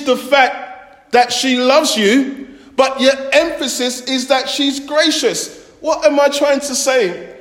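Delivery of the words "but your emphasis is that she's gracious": normal talking voice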